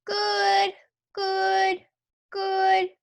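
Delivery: put-on high, toneless little-boy repetition; little kid echoing a grown-up; toddler voice. A narrator's reading. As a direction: parrot it in a small child's high voice. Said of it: "Fudge said".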